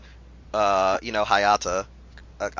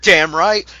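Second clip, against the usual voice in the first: ordinary talk, nothing marked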